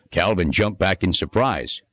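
ordinary delivery

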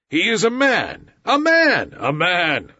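high, loud snarling wolves; bay it out as an angry mob; pack snarling. A narrator's reading. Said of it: "snarled the pack".

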